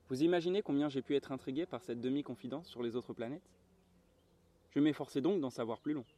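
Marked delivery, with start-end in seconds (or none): none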